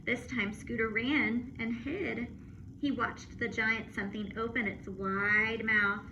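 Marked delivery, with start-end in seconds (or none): none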